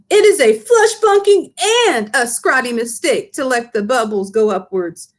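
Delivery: high, eager giant voice with muddled grammar; the BFG lecturing, indignant; big friendly giant. Acted. Giant rants at Sophie.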